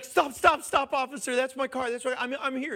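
loud, high panicked calling out; frantic shout. Retold or imitated. Man runs to the officer, panicked shout. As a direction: shout after him to stop writing the ticket.

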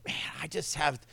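soft whisper of a confidence; hushed confiding. Retold or imitated. To the Texas lady over breakfast, a soft whisper. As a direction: lean in and whisper it. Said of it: "I look at her and I say".

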